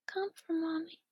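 ordinary delivery

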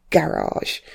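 "garage" as guh-RAHZH, stress on the second syllable; American garage. Shown as a demonstration. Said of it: "felt really unnatural to me".

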